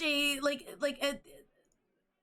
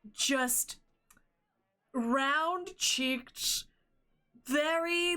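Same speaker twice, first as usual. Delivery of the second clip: ordinary talk, nothing marked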